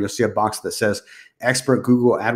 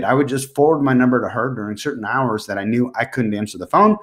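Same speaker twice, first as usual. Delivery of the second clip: ordinary talk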